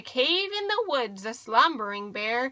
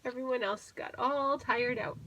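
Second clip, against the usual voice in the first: ordinary delivery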